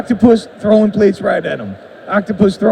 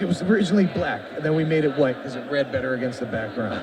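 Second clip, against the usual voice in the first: lower, softer behind-the-scenes explainer; calm insider commentary tone; professional voice; 5 semitones lower, 6 dB quieter than usual. Real, not acted. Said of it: "I like your professional voice".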